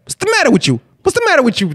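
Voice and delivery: high, harsh mob scolding; De Niro scolding. Retold or imitated. Imitating De Niro scolding a guy in Goodfellas, high and harsh. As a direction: berate him without letting up. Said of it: "De Niro qui te chicane dans Goodfellas".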